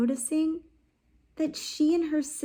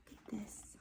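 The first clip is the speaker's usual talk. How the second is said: hushed whisper; 2 semitones lower, 23 dB quieter, faster than usual. Real, not acted. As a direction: lean in and whisper it. Teacher whispers to class.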